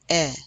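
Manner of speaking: E said like the vowel in "red"; E with grave accent. Shown as a demonstration. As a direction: open "eh" sound for E. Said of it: "as in the word red".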